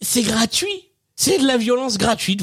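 put-on a cranky old grandpa, half laughing; very old man. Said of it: "C'est mieux avec la voix".

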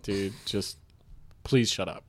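softly, fed up; quiet mock annoyance. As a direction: mutter it tiredly. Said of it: "You're like".

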